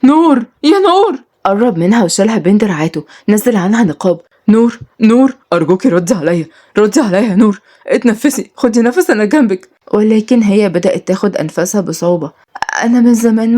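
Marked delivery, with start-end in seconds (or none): 0.0-1.3 loud, high, drawn-out cry of her name; frantic scream. Acted. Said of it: "صرخ بجنون". 4.5-9.7 desperate pleading. Acted. Ezz begs dying Nour.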